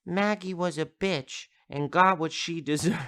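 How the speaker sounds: put-on high little-kid voice, breaking into a laugh; blunt six-year-old. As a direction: imitate a small child being blunt. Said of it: "a six-year-old say that so bluntly".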